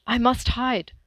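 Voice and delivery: loud, frightened, high cry; panicked cry. Acted. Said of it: "Manfred cried".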